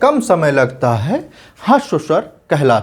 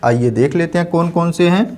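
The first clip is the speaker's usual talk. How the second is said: ordinary delivery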